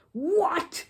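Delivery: high loud outraged yelp; a sudden furious bellow. A narrator's reading. Mr Curry, hearing the trick went wrong, bellows high and loud. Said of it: "bellowed Mister Curry".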